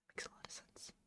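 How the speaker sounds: whispered repeat to herself. Real, not acted. Repeating to herself in a whisper. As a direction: breathe it out as a whisper. Whispered echo of own words.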